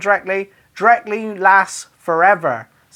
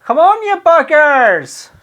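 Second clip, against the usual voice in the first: louder, slow, fed-up shout at the team; mock shout at the rugby; 7 semitones higher, 6 dB louder, slower than usual. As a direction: call out to the pitch, drawn out and slow. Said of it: "you could just shout".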